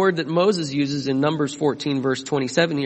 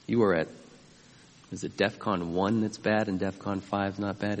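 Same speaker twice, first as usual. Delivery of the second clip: normal talking voice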